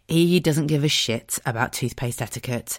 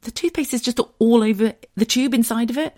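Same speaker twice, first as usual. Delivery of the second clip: tag explaining; 9 semitones higher than usual. Retold or imitated. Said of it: "I was like".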